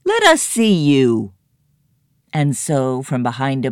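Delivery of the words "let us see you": pig calling out. A narrator's reading. Pig calls to the stranger.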